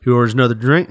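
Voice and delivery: ordinary talk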